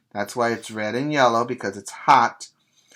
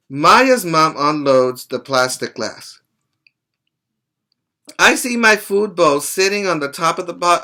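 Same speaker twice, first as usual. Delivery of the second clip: put-on louder, brighter read-aloud for children; lively story reading; 3 semitones higher, 3 dB louder than usual. Teacher reading Sam's story to the class, lively read-aloud. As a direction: bring the dog's story to life for the class.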